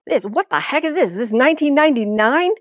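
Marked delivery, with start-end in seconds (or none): none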